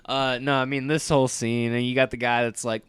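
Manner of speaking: ordinary talk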